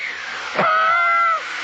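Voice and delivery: big hearty laugh. Real, not acted. Instructor laughs at own joke.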